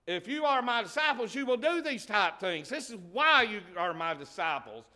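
lightly voiced Jesus, high and loud; Jesus speaking to his disciples, lightly voiced and forceful; voicing Jesus.

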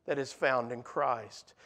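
that is found in Christ, dropped low and quiet; soft, hushed repeat. Shown as a demonstration. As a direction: let the line sink to a hushed whisper-like close. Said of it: "Nothing compares to the peace and quiet".

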